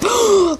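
sharp mock gasp, pitch jumping up; imitated gasp. Copying the cartoon gang's reaction, a mock gasp. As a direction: gasp like the gang unmasking a monster. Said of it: "like they gasped".

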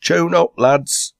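high, loud rallying call; crying out. A narrator's reading. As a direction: rouse the lads to start singing. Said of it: "and crying out".

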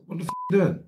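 high-pitched indignant challenge; mock outrage without extra volume; acted confrontation. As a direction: retell an angry demand, pitched right up. Acted quarrel over unpaid money.

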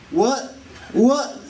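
ordinary talk

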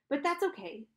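plain reading voice

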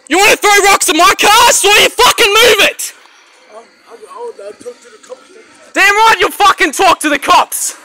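real angry yelling, pitched high; furious shouting. Real, not acted. Man ordering rock thrower back to the coppers, furious shout. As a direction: bellow orders at someone running off.

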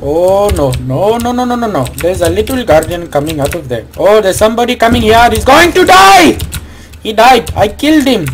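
put-on high, agitated Indian-accented exclaiming; hammed-up panic in an Indian accent; mock Indian accent. Someone coming here to die, panicky mock Indian accent. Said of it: "any Indians out there".

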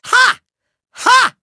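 usual speaking voice

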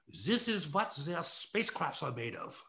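light mock German accent, stiff and foreign; a hint of a German accent, half-hearted; faux German accent. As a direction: play the old German man with a put-on accent. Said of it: "the faux German accent now".

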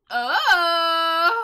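loud, drawn-out cry of shock; high, sustained shocked wail. Real, not acted. Shocked reaction to game event.

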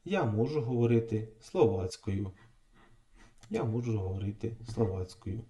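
ordinary talk

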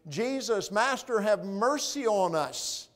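high, slow pleading call; crying out. Acted. Ten lepers calling to Jesus as he passes, pleading cry. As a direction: beg loudly from a distance. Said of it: "what are they crying out".

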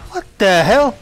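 high, loud what the hell; startled indignant yelp; shocked outburst. Real, not acted. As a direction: blurt it out in shock. Sudden hit in the fight.

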